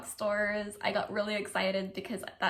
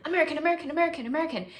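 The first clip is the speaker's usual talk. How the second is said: high, louder, run-together burst of repeats; excited overload; 7 semitones higher, 4 dB louder, much faster than usual. Retold or imitated. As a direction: fire them off like a brain on overload. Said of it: "it was like this overload".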